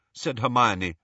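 usual speaking voice

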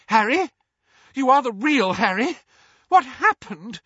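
high, loud panicked questions; a mother terrified for her family; frantic cry. A narrator's reading. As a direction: Mrs Weasley cries out as Harry lands. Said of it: "cried Missus Weasley".

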